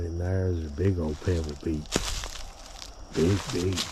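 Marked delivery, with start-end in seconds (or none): none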